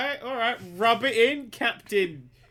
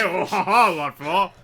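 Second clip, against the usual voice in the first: ordinary talk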